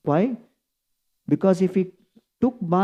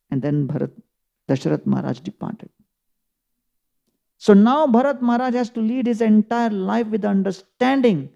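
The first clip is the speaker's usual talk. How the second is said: ordinary talk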